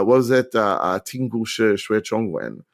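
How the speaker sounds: ordinary talk, nothing marked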